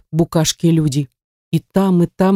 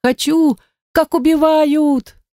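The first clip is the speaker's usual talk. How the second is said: put-on eager child; 9 semitones higher, slower than usual. A narrator's reading.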